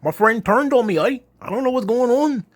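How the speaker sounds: high-pitched, hammed-up Aussie accent; mocking Australian impression, high and exaggerated; crazy mock Australian accent. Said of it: "like, a, this crazy accent".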